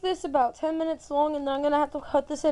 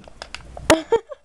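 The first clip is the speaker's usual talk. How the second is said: short laugh that builds louder; burst of laughter; 3 semitones higher, 5 dB louder than usual. Real, not acted. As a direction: break into a quick laugh. Amused while playing the game.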